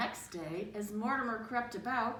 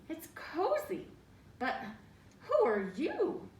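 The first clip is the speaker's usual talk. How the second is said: high mouse voice; 7 semitones higher, 4 dB louder than usual. Acted. Lightly voiced mouse surprised by the tiny figures. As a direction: squeak up in surprise. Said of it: "said Mortimer".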